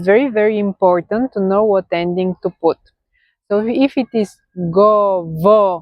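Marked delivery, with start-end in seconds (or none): 4.7-5.7 govoria broken up with a heavy VO, go-VO-ria; stress not at end. Shown as a demonstration. Teacher showing learners where stress falls, govoria with drawn-out stressed vo. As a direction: stretch and hit the vo of govoria. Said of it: "so not in the end".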